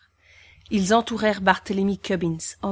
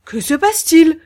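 much higher, louder startled gasp; breathless cry; 9 semitones higher, 10 dB louder than usual. Acted. Executioner seeing another hat appear, crying out. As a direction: cry out in shock. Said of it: "s'écria-t-il le souffle coupé".

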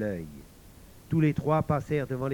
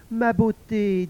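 high, louder call; wicked woman coaxing; 8 semitones higher, 5 dB louder, slower than usual. A narrator's reading. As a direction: call sweet-talking in a high voice. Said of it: "dit la mauvaise femme". (mauvaise femme qui appelle, voix aiguë)

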